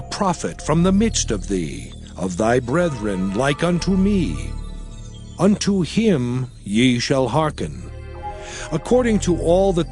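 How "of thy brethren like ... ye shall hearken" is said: normal talking voice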